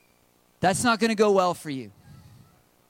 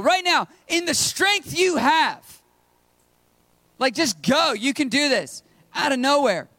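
full-force preaching; 6 semitones higher than usual. Acted. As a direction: preach it out, urging them to go.

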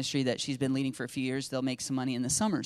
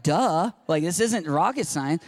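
louder mock-obvious teen tone; duh; 5 dB louder, slower than usual. Daughter to dad on how simple tithing is, duh. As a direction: imitate someone pointing out the blindingly obvious. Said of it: "She said it like that".

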